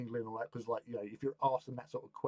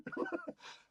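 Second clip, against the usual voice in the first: breathy laugh; 6 dB quieter than usual. Real, not acted. Laughing at the story.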